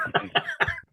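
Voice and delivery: squeaky shocked laugh; high burst of laughter. Real, not acted. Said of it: "look at the look on his face".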